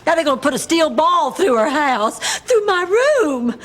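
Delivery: rising into a sob, slowing at the end; crying over losing her childhood home. Acted. To her friends, sob over the wrecking ball. Blanche mourns house's demolition.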